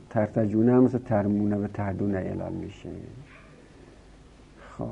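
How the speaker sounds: usual speaking voice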